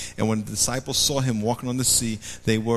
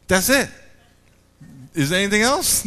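put-on child's letdown; 7 semitones higher than usual. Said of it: "and you go".

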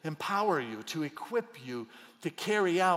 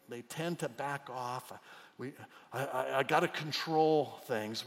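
ordinary talk, nothing marked

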